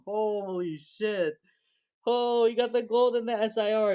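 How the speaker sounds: high, rising thrill; excited disbelief. Real, not acted. Streamer telling chat the buyer got both cards. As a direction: burst out in amazement. Realising both hits landed.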